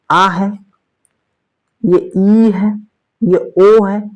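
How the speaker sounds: आ, ई, ओ held long before है; long vowels. Shown as a demonstration. Teacher pointing out आ, ई, ओ in examples, vowels held long. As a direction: lengthen the vowels आ, ई, ओ. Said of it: "थोड़ा लंबा जा रहा है".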